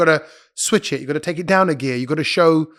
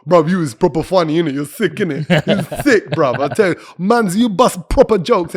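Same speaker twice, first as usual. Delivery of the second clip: lightly voiced London roadman slang, a touch louder; imitating a London fan; 3 dB louder than usual. A fan gushing to the comedian after his set, in a light London slang voice. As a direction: hype him up like a street fan after the show.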